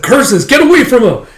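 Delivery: high, loud battle yell; angry shout. Acted. Soldier drives dragon off his general, angry shout.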